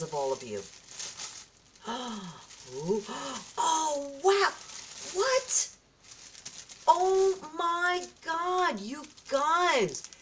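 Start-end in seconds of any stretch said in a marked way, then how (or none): 1.8-2.7 soft, breath-filled gasp; real gasp of wonder; amazed gasp. Real, not acted. Sees more gifts. 2.8-10.1 high-pitched, incredulous amazement; real squeal of disbelief at a gift; can't believe what you've been given, pitched way up; stunned delight. Real, not acted.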